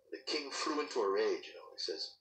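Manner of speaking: plain reading voice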